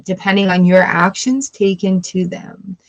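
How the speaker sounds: ordinary talk, nothing marked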